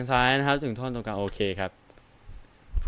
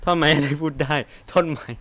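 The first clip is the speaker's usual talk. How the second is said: incredulous; 3 semitones higher, 3 dB louder than usual. Shown as a demonstration. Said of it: "ไอ้ที่กูเล่นมาตั้งแต่เริ่มนี้ก็คือแบบ".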